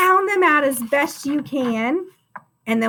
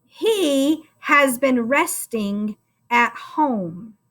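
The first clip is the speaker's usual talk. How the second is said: very slow choral reading, each word spaced; much slower than usual. Shown as a demonstration. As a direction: drag it out so the kids can follow along. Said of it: "Say it with me, friend".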